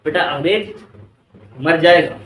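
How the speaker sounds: normal talking voice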